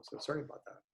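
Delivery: softly whispered aside; hushed sorry under the breath; whispered apology. Real, not acted. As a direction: drop to a quick whisper to apologize. Aside apology after phone call.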